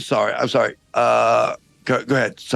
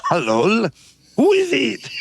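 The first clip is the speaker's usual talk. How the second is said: put-on French accent. French guard calling down from the castle wall, hammed-up French accent. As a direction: ham up a silly French guard. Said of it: "this outrageous accent".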